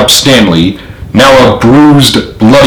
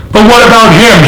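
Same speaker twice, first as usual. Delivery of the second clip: much higher, alarmed cry; cried out; 12 semitones higher than usual. A narrator's reading. General crying out about James, much higher. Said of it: "he cried".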